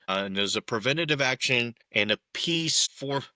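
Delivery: ordinary talk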